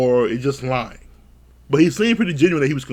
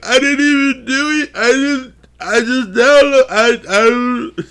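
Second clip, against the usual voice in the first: higher, louder stammering guy voice; flustered explaining; 8 semitones higher, 4 dB louder than usual. Retold or imitated. Husband pleading with the producers, high and stammering. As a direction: sputter the excuse, stumbling over words. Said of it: "trying to explain to the producers".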